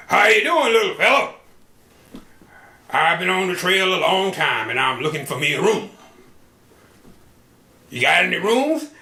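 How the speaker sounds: loud hearty cowboy. Acted. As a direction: boom like a trail-worn cowboy. Cowboy asks for room.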